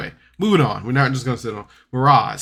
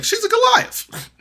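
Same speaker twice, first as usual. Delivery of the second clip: exasperated; 5 dB louder than usual. Retold or imitated. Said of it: "I'm just like".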